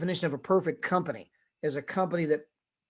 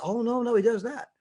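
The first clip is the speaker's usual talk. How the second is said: higher, airy denial; wife denying to the pastor; lightly voiced wife; 6 semitones higher than usual. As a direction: brush it off sweetly. Wife answering.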